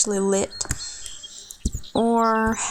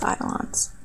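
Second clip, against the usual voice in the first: breathy whisper of eidolons; 5 dB quieter than usual. Shown as a demonstration. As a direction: breathe the word eidolons softly. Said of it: "echoed word, whispered".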